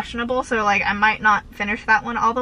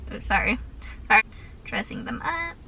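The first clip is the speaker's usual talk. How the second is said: normal talking voice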